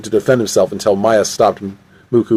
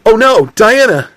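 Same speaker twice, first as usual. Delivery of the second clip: high-pitched cry of alarm; acted dismay, pitched high; 11 semitones higher, 4 dB louder than usual. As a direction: cry out as a companion falls. Companion falls down sand hill.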